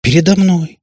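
high, loud "мной" thrown back in disbelief; startled protest. A narrator's reading. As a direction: bounce up with outraged surprise. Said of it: "подпрыгнула теперь и Катя".

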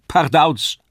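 loud, high clown cry bursting onstage; puppet's shout. A narrator's reading. Kasperl the puppet bursts onto the stage with a loud cry. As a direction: shout it like a jester leaping in. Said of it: "so schrie es".

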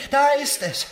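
sudden high, loud exclamation; rapturous cry. Acted. Rodolphe to Emma, crying out ecstatically. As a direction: burst out as if a voice called.

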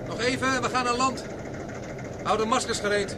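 calling out over the noise. Acted. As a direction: push the voice out over roaring wind. Landing near roaring gas column.

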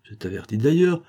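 usual speaking voice

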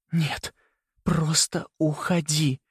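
high, slow desperate plea; desperate, faltering. A narrator's reading. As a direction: plead slowly, voice wavering with despair. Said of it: "дрогнувшим от отчаяния". (с отчаянием, дрогнувшим голосом)

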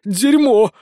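high, loud swearing; cursing. A narrator's reading. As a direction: spit out an alarmed curse. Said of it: "выругался Сисюля". (громко ругаясь)

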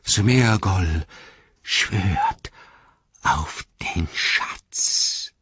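slow, halting creature voice fading to breath; Gollum, solemn. A narrator's reading. Gollum swearing on his precious to Frodo, solemn rasp.

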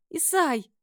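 very high little yelp; plaintive squeak. A narrator's reading. Frightened mistress calls her slave, squeaking plaintively. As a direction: squeak for help. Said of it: "жалобно пискнула я".

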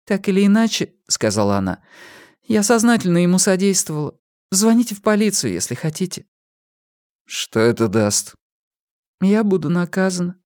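put-on young woman voice. A narrator's reading. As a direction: confess timidly as a young woman. Pip voiced by narrator.

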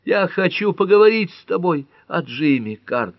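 high, loud despairing plea; desperate cry. A narrator's reading. Pat begs Cart to talk about Jimmy, desperate cry. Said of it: "в отчаянии крикнула Пэт".

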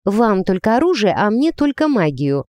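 usual speaking voice

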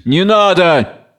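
mildly acted shout of refusal; crying out. A narrator's reading. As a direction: cry out slowly in protest. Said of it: "Кричит".

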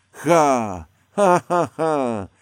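loud, slow mocking cackle; scornful laugh. A narrator's reading. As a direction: laugh right in his face. Scornful stage laughter mid-quarrel.

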